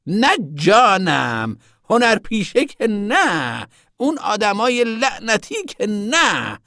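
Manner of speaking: high, loud, very drawn-out no; sudden emphatic denial. A narrator's reading. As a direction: burst out, stretching every denial. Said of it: "زن ناگهان گفت". (ناگهانی، بلند و خیلی کشیده)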